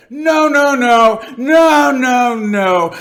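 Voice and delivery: high, drawn-out shouted noes; vehement protest. Acted. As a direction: shout a long refusal, easing down at the end. Interlocutor protests ever harder.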